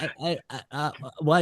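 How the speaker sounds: small, hesitant mock stutter; flustered stammer. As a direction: imitate a taken-aback receptionist. Flustered receptionist after being cussed out.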